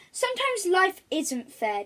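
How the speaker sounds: wise butler. Acted. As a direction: speak as the wise old butler. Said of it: "Jenkins said wisely".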